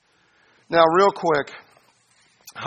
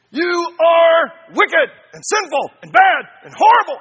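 much higher, louder, slow mock tirade; condemning shout; 13 semitones higher, 10 dB louder than usual. Preacher mocking a condemning Holy Spirit, yelling at the sinner. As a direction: shout the accusations, hammed-up. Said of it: "the Holy Spirit comes and he goes".

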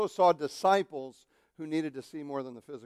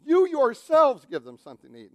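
"You" punched louder and higher; louder, higher stress on "You"; 5 semitones higher, 8 dB louder than usual. Shown as a demonstration. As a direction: hit "You yourselves" hard. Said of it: "the emphasis is on the word "you".

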